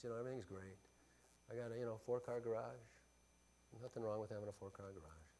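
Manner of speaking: soft, self-satisfied musing; smug complacency. Pretend. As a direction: coast along as if all is well. Complacent believer thinking all's fine.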